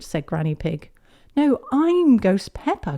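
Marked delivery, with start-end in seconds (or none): 1.4-3.0 put-on high, loud insistent little girl; child correcting. Peppa correcting Granny.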